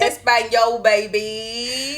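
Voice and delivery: loud, drawn-out mimicry; hammed-up mock Spanish; mocking echo. Teasing friend's attempt at Spanish, loud mimicry.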